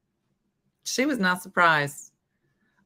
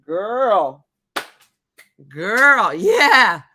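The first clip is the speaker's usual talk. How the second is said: put-on loud, sassy "girl"; mock warning; 7 dB louder, much slower than usual. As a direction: scold the kid in loud disbelief. Acting out her warning reaction.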